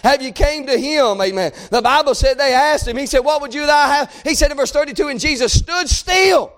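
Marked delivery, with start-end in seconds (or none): 1.7-6.5 raised voice, stretching the last words into a shout; building preaching climax. Acted. Preacher telling how a cry halted God, building to a shout.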